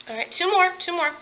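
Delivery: normal talking voice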